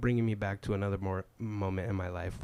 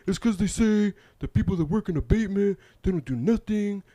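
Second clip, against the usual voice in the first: very high, louder upset squeal; whiny girly voice; 10 semitones higher, 8 dB louder than usual. Retold or imitated. Coworker complaining to the crew, very high whine. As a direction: shriek it up high like a tattling kid.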